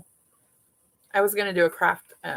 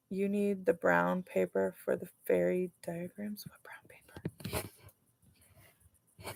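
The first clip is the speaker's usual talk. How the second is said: breathy hushed whisper, no voice; 10 dB quieter than usual. Real, not acted. Whispering to her helper off to the side, hushed. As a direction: whisper it so the recording doesn't catch it. Whispered exchange during filming.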